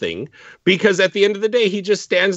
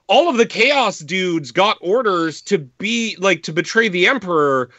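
ordinary talk

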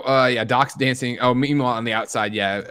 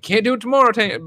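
mock Marty voice; 12 semitones higher, 6 dB louder than usual. Marty voiced in retold scene.

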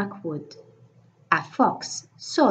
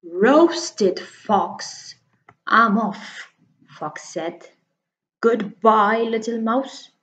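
louder, alarmed fox bolting; frightened fox; 3 semitones higher, 4 dB louder, slower than usual. Acted. Fox scared by the mouse's tale, loud alarm. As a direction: panic and hurry off.

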